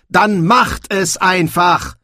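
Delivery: high, loud, drawn-out outburst; shouting. A narrator's reading. Young man cuts through the quarrel, shouting. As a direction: shout each word slowly in exasperation. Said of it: "dass er geschrien hatte".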